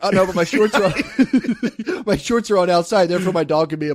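high, loud protest breaking into laughter; mock protest. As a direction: argue an absurd defense, cracking up midway. Acted legal protest, laughing midway.

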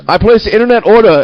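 high, loud haughty demand; entitled rich student. Retold or imitated. Mocking the student who cut the line, haughty. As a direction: mimic a pushy rich kid cutting in. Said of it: "puts his phone in her face and goes".

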